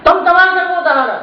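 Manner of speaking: high, loud declaimed line, falling at the end; poem recitation. Acted. Poet tells of the sun roaring, loud declamation. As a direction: throw the line out to the crowd.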